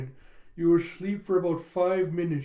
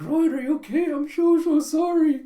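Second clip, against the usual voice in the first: much higher and louder, panicked apology; frantic apology; 9 semitones higher, 8 dB louder than usual. Retold or imitated. Yor apologizing to the man she kicked, loud and frantic. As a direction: rush to help, horrified. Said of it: "It's like".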